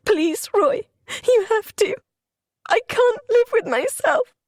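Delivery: desperate pleading. Acted. Wife begging dying husband.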